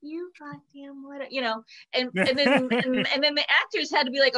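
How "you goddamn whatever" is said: sweet and much softer, a curse said nicely; sweetly. Retold or imitated. Chinese advisor reading a curse to the cast, sweetly and softly. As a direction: coo the curse like a compliment. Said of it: "really sweetly in Chinese".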